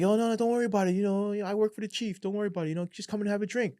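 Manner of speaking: high-pitched smooth talker; pushy driver. Retold or imitated. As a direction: wheedle him into the drink. Driver pushing a drink on him.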